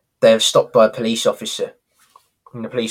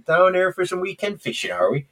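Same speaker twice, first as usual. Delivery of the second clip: West Country policeman, a bit higher; West Country accent; 6 semitones higher than usual. Retold or imitated. Policeman quizzing Del Boy about fishing, West Country accent. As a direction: give the copper a Cornish burr. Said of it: "Smashed it".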